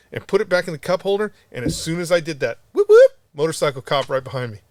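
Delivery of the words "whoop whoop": a loud, mock police-siren whoop. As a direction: blast it out like a cop's siren. Imitating motorcycle cop siren.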